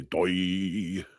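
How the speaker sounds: drawn-out Scottish exclamation; Scottish accent. Retold or imitated. Host imitating chemistry teacher, stretched Scottish accent. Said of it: "He was Scottish".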